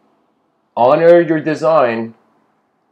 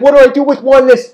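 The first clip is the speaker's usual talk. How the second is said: higher, louder, fast bewildered cry; anxious plea; 11 semitones higher, 8 dB louder, faster than usual. Acted. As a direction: blurt the question in alarm. Person voiced in parable.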